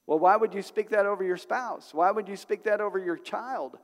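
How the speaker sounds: ordinary talk, nothing marked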